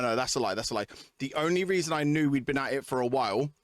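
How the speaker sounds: usual speaking voice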